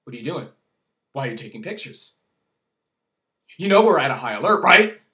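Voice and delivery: mock aggressive cop interrogation. Officer grilling the speaker over photos, aggressive. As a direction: fire questions like a pushy cop. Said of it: "he comes at me and he goes".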